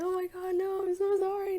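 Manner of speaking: high, loud panicked apology; flustered apology. Retold or imitated. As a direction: gush sorry in a panic. Panicked apology to angry instructor.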